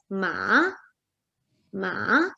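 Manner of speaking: "ma" falling, then rising; third tone, dipping. Shown as a demonstration. Teacher shows student the third tone, falling-rising "ma". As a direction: dip the pitch on "ma", then lift it. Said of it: "it's falling and then rising".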